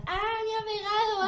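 high, hammed-up tattling cry; mock yell. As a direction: imitate a kid faking being hit. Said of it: "empezaba a gritar".

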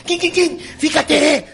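high chirpy bell imitation; hammed-up cyclist warning; mock cyclist bell. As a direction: ring out a mock bike bell, then scold. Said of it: "Los de las bicicletas son".

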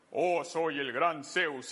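low, slow, booming god; mock Zeus voice. Preacher mocking the idea of God as Zeus, in a low slow booming voice. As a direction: puff up like a grand Greek god. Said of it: "Dios es como Zeus".

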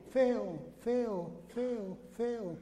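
fading echo repeats. Retold or imitated. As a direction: let each "feo" die away like a cave echo. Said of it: "el eco le respondió".

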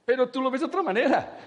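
high, loud, laughing mock outrage; laughing rant. Speaker tells the lady her husband never changed, laughing loudly. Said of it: "Y dice".

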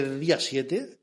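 ordinary delivery

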